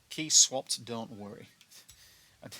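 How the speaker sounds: ordinary talk, nothing marked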